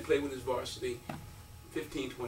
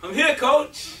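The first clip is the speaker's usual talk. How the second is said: high, loud arrival call; proud announcement; 11 semitones higher, 9 dB louder, much slower than usual. Retold or imitated. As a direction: call out to announce your grand entrance. Announces his arrival on campus.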